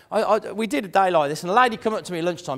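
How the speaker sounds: ordinary delivery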